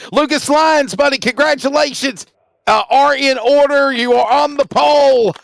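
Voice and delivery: high-pitched celebratory announcer delivery; hearty congratulations. Real, not acted. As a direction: congratulate the pole winner with lifted pitch. Host congratulates pole winner.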